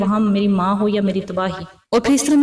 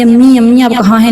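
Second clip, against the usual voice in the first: mildly raised voice, calling for mother; crying out; 3 semitones higher, 12 dB louder than usual. A narrator's reading. Daughter calling her missing mother in the dark, mild cry. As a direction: call out for mother with a touch of alarm. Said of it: "میں نے کئی بار چیخ کر امی کو آواز دی".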